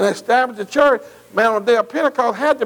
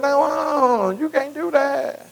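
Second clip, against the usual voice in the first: higher, pleading voice of the protesting disciples; 5 semitones higher than usual. Retold or imitated. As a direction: plead with him not to leave. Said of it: "And they said to him".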